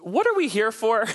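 high, baffled couple voice; mock the confused couple; puzzled couple. Couple in counseling balks at the pastor, high and baffled. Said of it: "they look at me like".

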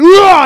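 strangled, high, loud choked groan; choked cry. Retold or imitated. Pastor reliving the polish-remover swig, strangled high cry. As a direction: force a cry out through a burned throat. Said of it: "But I couldn't make any noise".

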